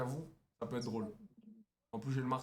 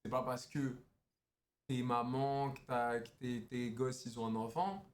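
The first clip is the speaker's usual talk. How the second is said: low, slow flirting voice; low seductive; 6 semitones lower, slower than usual. Acted. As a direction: seduce her with a deep voice.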